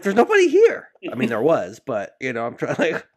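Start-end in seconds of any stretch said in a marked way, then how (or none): 0.0-0.9 high, loud fake innocence; calling out. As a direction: shout it through the door. Speaker calling back to ex.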